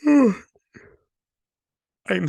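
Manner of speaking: high, loud real yawn. Real, not acted. Vlogger interrupted mid-recap by tiredness, yawning. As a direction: let a big yawn swallow the word. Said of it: "so sorry for yawning".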